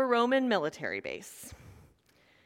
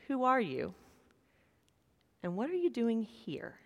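soft, hushed question to the congregation; preacher asking the congregation, quiet and sincere; 9 dB quieter than usual. Real, not acted. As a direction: ask it gently and let it land.

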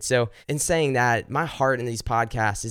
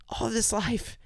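softer echo; 9 semitones higher, 6 dB quieter than usual. Acted. Repeated softer in prayer.

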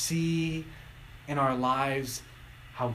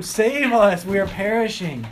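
panicked plea; 6 semitones higher, 9 dB louder than usual. Retold or imitated. Panicked disciples wake Jesus.

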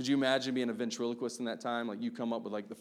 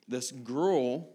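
normal talking voice

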